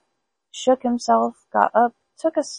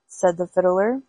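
ordinary delivery, nothing marked

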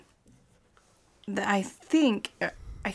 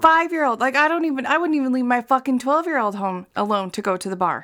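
higher and louder, fired up; indignant; 4 semitones higher, 6 dB louder than usual. Real, not acted. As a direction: spit it out in disbelief. Continues outraged about neglect.

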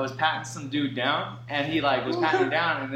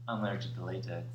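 mock deadpan excuse; 9 semitones lower, 12 dB quieter than usual. Guard voiced excuse.

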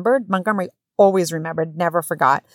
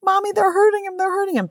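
put-on high, distressed little-kid voice; imitated crying child; 14 semitones higher than usual. Small son crying to mom about brother, high wail. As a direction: cry out to mommy in an imitated small voice. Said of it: "Truman's crying now".

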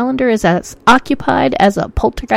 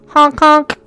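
higher goofy honking noise; clown horn honk; 5 semitones higher, 4 dB louder than usual. Pretend. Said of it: "I felt like having a honk in there".

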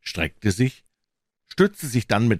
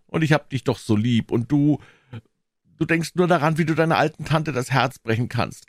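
higher, aggrieved aunt; wounded, chiding tone; hurt reproach; 8 semitones higher than usual. Acted. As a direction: make him feel guilty. Aunt reproaches boy for heartbreak.

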